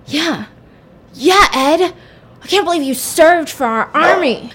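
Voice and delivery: high, mock pumped-up cheering; amped up. Accomplice fired up by the army speech, hammed-up excitement. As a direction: hype yourself up in imitation. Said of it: "getting amped up".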